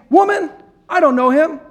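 loud, high, defensive denial. Acted. Peter snapping back at the servant girl, loud denial. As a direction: push the accusation away hard.

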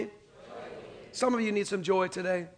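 normal talking voice

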